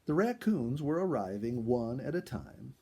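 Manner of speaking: usual speaking voice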